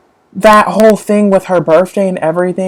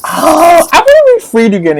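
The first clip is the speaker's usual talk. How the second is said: excited; 12 semitones higher, 6 dB louder than usual. Real, not acted. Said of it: "you guys".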